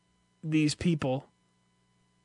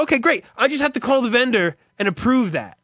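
eager agent voice; 7 semitones higher, 4 dB louder, faster than usual. Retold or imitated. Imitating shipping agent.

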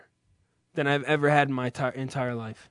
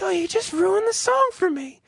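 high whiny mock of his wife's complaint; 3 dB louder than usual. Mimicking his partner's complaint about the song in a high mock whine. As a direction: squeak it out like a put-upon partner. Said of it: "and she's like".

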